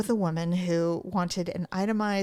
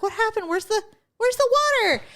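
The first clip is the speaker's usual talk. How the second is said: 1 panicked confusion; 13 semitones higher, faster than usual. Retold or imitated. No water in hotel shower.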